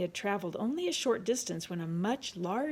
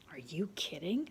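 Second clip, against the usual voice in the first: hushed whisper; 5 dB quieter than usual. Acted. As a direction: whisper it in the dark. Whispering at night camp.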